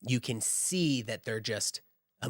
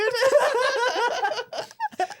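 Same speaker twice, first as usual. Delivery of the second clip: loud high laugh; 7 dB louder than usual. Real, not acted. GM laughing hard.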